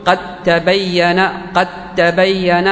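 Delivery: slow قد تبين said as "qattabayyan", doubled T; idgham merging. Shown as a demonstration. Teacher showing how to say the rule, قد تبين slowly with D turned to T. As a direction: merge the dāl of قد into the tā of تبين. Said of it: "فيكون عندي إدغام".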